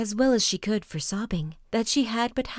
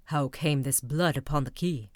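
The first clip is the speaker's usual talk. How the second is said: lower, mildly stern husband; Bluebeard's voice; 6 semitones lower than usual. A narrator's reading. Husband demanding the key from his wife, low and stern.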